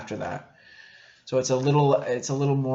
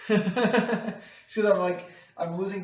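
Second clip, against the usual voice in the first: laughing; 7 semitones higher, much slower than usual. Real, not acted. Laughs at his own joke.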